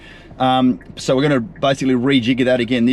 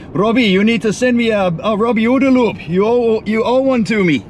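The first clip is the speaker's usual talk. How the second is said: bad Brazilian accent; 8 semitones higher than usual. Marketing manager Noah demanding her Robbie episode, in a bad Brazilian accent. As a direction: mimic a colleague nagging in a jokey Brazilian accent. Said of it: "I'm gonna do a really bad Brazilian accent here".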